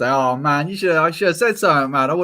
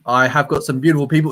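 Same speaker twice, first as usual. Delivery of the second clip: normal talking voice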